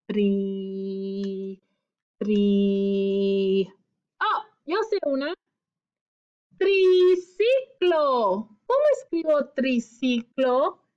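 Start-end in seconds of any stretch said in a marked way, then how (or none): 0.1-3.7 low murmured "Tri, tri" while searching; thinking aloud syllable. Shown as a demonstration. Teacher pretending to search for a tri word, musing. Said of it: "pensar en una palabra". 6.6-8.5 "Triciclo" drawn out very slowly, syllable by syllable, louder; stretched-out word. Shown as a demonstration. Teacher stretching the word so children hear each syllable. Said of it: "Hay que estirar la palabra".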